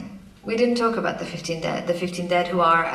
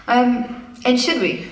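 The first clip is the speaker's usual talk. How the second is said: normal talking voice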